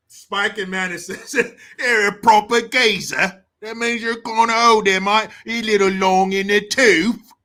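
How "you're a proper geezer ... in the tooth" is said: loud, hammed-up British geezer; mock British accent. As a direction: belt it out in fake British. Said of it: "I wanna say in this accent".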